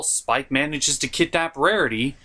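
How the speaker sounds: ordinary delivery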